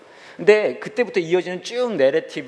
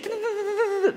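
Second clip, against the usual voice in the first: rapid high stuttered machine whirr; scanning noise; slower than usual. Acted. Pastor's head searching for a face, mimicked scanning sound. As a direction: imitate a scanner racing through data. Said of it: "막 스캐닝".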